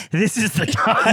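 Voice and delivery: high-pitched announcement through laughter; laughing reveal. Retold or imitated. Prankster exposing the man on his phone, laughing reveal. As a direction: break into laughing on the punchline. Acted punchline of planned prank.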